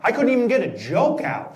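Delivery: normal talking voice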